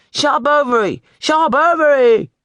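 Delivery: very high, loud mocking chant of the name; the class jeers the boy's name, building; mocking yell. A narrator's reading. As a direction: schoolboys yelling and mocking the new boy. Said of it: "They yelled".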